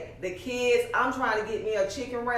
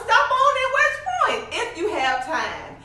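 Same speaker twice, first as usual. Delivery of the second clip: much higher, laughing eager plea; eager call; 13 semitones higher, 5 dB louder than usual. Retold or imitated. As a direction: call out with a laugh, begging him to stop by. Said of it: "I was".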